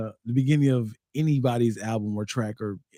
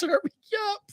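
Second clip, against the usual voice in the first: high-pitched mock demand; 5 dB quieter, much faster than usual. Rapper demanding volume.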